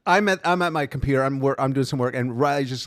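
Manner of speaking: ordinary talk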